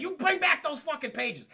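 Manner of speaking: high mock-furious yell; angry demand. Demona chasing Brooklyn for the pages, furious. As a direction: yell it after someone fleeing.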